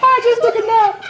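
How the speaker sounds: high, laughing mock excuse. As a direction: sell the lame excuse with a giggle. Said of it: "I was like".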